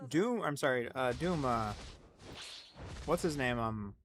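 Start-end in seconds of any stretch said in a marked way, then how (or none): none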